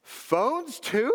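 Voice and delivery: high, baffled question; mock disbelief. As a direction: play the clueless traveler, astonished. Acts clueless traveler asking.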